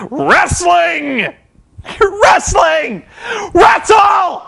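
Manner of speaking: high, loud exasperated yells; frustrated shouting. Real, not acted. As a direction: lose it and scream at the show. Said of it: "Kinda lost it for a little bit".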